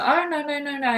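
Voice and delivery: high, sing-out string of refusals; mock protesting, pitched high; hasty refusal. Voicing police turning down her offer.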